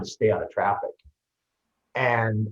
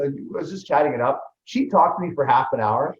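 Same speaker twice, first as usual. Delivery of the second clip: ordinary talk, nothing marked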